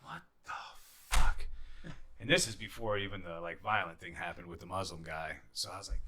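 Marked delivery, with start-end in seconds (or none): 0.0-1.5 slow, whispered disbelief; stunned whisper. Retold or imitated. Finding shampoo stolen.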